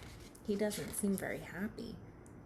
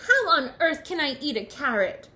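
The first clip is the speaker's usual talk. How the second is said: loud, raised, indignant question; worked-up picky Bird; exasperated shout; 4 semitones higher, 9 dB louder than usual. Acted. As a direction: protest loudly in disbelief. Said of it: "shouted Bird".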